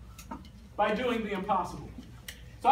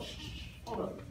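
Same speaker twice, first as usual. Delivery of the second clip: hushed, voiceless shushes; acted shushing; 14 dB quieter, faster than usual. Young David halting everyone around him, hushed shushing.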